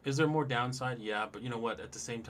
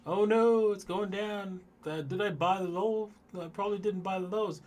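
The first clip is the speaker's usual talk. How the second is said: high, loud panicky squeal; mock panicked trader; 10 semitones higher, 7 dB louder than usual. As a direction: squeal it like a trader watching a crash. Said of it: "and then".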